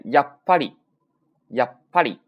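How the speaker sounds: やっぱり cut short after や, silent beat, then ぱり burst out loud; sokuon pause demo. Shown as a demonstration. Teacher models the small-tsu pause for learners, held then released. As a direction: punch out やっぱり in two halves round a held stop. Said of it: "这种感觉啊".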